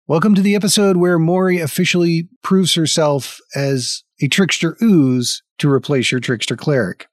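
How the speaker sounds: normal talking voice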